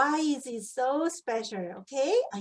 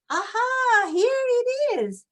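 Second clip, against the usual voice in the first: excited reveal; 9 semitones higher, 5 dB louder than usual. Shown as a demonstration. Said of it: "revealing page".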